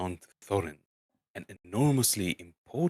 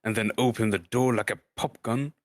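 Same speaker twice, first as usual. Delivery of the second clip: Gandalf's voice; 2 semitones higher, 3 dB louder than usual. Acted. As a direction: boom out the introductions like a grand old wizard. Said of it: "That's a Gandalf".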